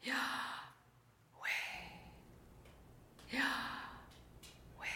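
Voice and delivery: Yahweh breathed unvoiced, Yah on the in-breath, weh on the out-breath; breathed name. Shown as a demonstration. Pastor teaching congregation the breath name, whispered. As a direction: say Yahweh as pure breath, in then out. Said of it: "first syllable on the in-breath and the second syllable on the out-breath".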